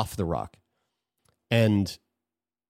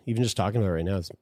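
emotional; 5 dB quieter, faster than usual. Real, not acted. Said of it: "It's making me emotional".

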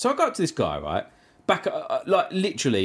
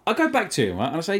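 ordinary talk

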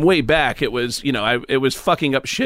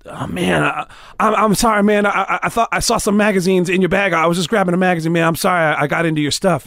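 flustered apology; 4 semitones higher than usual. Retold or imitated. Mitch caught snooping, apologizing.

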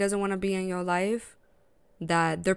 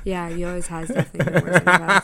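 laughing through a teasing line; 11 dB louder than usual. Real, not acted. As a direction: tease her and burst into laughter.

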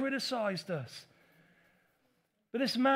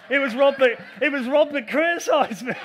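louder, laughing confession; real laughter through the words; 5 semitones higher, 10 dB louder than usual. Real, not acted. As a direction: laugh while naming Rob. Laughs naming Rob.

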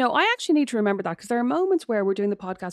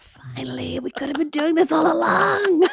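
mock lovestruck; 7 semitones higher, 5 dB louder, slower than usual. Mock lovestruck reply, laughing.

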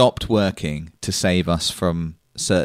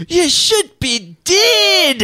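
put-on very slow, much higher thick Scottish accent; terrible Scottish impression; 3 dB louder, much slower than usual. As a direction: ham up a Scottish mechanic's warning. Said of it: "thick Scottish accent that I will now do a terrible impression of".

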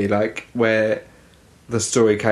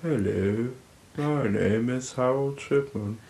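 flat, droning monotone, a touch softer; 2 semitones higher, 7 dB quieter than usual. Pretend. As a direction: read it like a dull accountant. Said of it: "really monotone voice".

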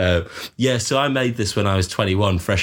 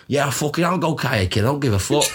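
higher, devil-may-care Manc quote; mock-Manc bravado, pitched up; retold Salford dad; 5 semitones higher than usual. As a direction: imitate a reckless Salford lad. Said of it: "and he went".

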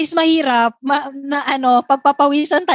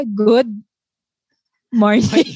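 said slowly; 3 semitones lower, much slower than usual. Retold or imitated. Replaying the take that angered ma'am, slow. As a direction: lower "Good", long gap, "morning". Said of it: "sabihin ko daw slowly".

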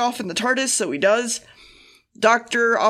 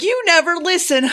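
mock complaint; 7 semitones higher, 4 dB louder than usual. Jo complaining to the doctor.

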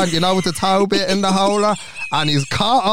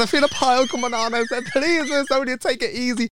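high, softer plea for mercy; mock pleading; 8 semitones higher, 3 dB quieter than usual. Acted pleading with rough lover.

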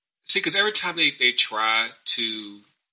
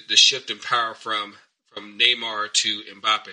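ordinary talk

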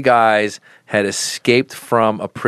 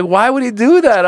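put-on puzzled kid; 13 semitones higher, 5 dB louder than usual. Son asking, retold.

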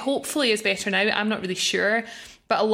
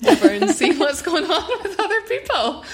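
laughing; 8 semitones higher, 4 dB louder than usual. Real, not acted. Laughing at own giraffe answer.

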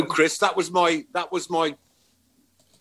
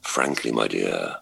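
very low, slow film line; heavy smoker's voice; slower than usual. Acted. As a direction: drop it very low and slow, like forty cigarettes a day. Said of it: "that forty Woodbines a day has done it".

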